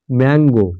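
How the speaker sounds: Mango in two beats, Mang, গো; syllable by syllable. Shown as a demonstration. Example of a disyllable, mango split as ম্যাং-গো. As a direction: give each syllable of mango its own beat. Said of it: "উচ্চারণ দুবারে হয়".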